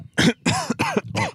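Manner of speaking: sudden high cough after swallowing a bug; choking cough. Real, not acted. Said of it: "Are you okay?".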